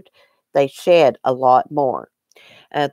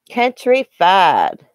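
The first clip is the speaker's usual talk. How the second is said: drawn-out word; 3 semitones higher, 3 dB louder, much slower than usual. Shown as a demonstration. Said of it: "you can tell people are countrified around here".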